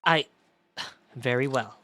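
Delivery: formal judge with a bit of weight; a hint of a solemn judge; stern judge. Voices the judge.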